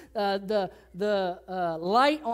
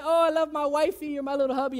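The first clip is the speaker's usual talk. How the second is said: mock gushing; 4 semitones higher, faster than usual. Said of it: "You say".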